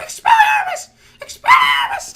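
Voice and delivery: Harry Potter. Retold or imitated. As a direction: shriek the spell in a squeaky kid voice. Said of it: "He keeps yelling".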